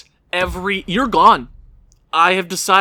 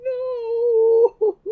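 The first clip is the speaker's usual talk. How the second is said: despairing cry. Real, not acted. Mock despair, cry heard.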